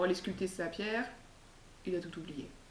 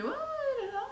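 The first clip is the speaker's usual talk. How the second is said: high, excited realization; eureka moment; slower than usual. Acted. Monk finally remembering his tables, high excited squeak. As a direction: squeak it out as it clicks. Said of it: "il fait".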